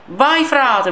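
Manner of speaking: urging cry. Real, not acted. Urging his player on.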